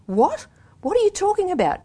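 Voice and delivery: high, bewildered question; baffled and defensive; surprised. A narrator's reading. Friend confused.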